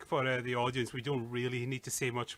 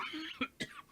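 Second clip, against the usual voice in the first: cough; 7 dB quieter than usual. Real, not acted. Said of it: "Excuse me, I'm coughing there".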